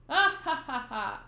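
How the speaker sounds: carefree acted ha-ha laugh; acted laughter. Pirate laughing at the angry mob, carefree. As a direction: laugh out loud, falling away.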